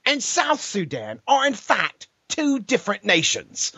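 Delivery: high, slow mock British debater; hammed-up British kid cross-examining; British accent. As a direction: mimic a smug British schoolboy asking. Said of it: "when the British kid gets up to ask his question".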